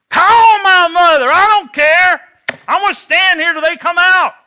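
big brother shouting he won't leave; defiant shout. Retold or imitated. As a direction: plant your feet and holler back at her. Brother defying the mother.

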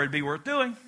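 usual speaking voice

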